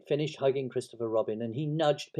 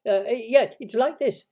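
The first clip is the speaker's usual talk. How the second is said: high, loud little squeak; quick, flustered Piglet; 13 semitones higher, 10 dB louder than usual. A narrator's reading. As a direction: jump in hastily to help. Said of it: "said Piglet quickly".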